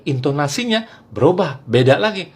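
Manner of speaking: normal talking voice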